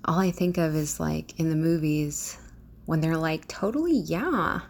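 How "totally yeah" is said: put-on drawn-out, swooping high Valley Girl. Vlogger quoting movie Valley Girls on request, Valley Girl accent. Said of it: "one of them was Valley Girl".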